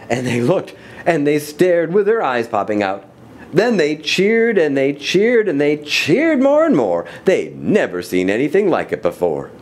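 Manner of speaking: animated, rhythmic storybook reading; story narration. A narrator's reading. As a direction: read the rhyme to children with big swings.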